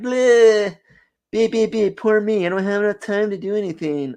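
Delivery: high, whiny mock complaining. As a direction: whine it like a sulky kid. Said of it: "I probably sound like a little, little whiny".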